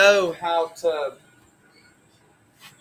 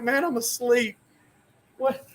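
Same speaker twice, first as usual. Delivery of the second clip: higher, bewildered protest; sleepy protest; 6 semitones higher than usual. Retold or imitated. Little brother to big brother, bewildered protest. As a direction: play the confused kid. Woken by brother hitting him.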